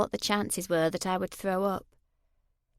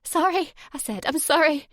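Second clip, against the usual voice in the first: higher, breaking, panicked sorry; terrified apology; 6 semitones higher, 4 dB louder than usual. Acted. Woman held over the gas burner, apologising in terror. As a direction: beg for it to stop.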